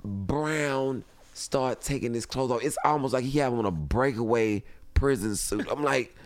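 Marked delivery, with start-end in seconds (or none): none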